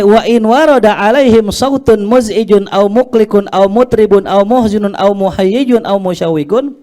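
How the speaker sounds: high, loud rolling Arabic recitation; forceful recitation. Acted. Preacher reciting an Arabic text to students, forceful recitation. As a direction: recite the list out at full force.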